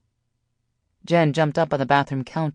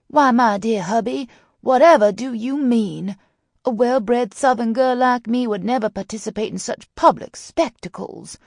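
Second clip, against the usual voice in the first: high, loud Southern belle; thick Southern accent; 8 semitones higher, 5 dB louder than usual. A narrator's reading. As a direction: lay on a teasing Southern accent. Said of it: "laid on the thick Southern accent".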